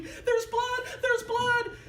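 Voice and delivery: high, loud terrified scream; screaming boy. Retold or imitated. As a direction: yell it like a frightened ten-year-old. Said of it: "he starts screaming".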